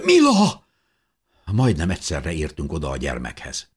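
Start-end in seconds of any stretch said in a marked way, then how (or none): 0.0-0.6 very high, loud cry; shouting. A narrator's reading. Mother calls her unconscious child through smoke, shouting. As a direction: scream the child's name, falling at the end. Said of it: "kiáltotta köhögve".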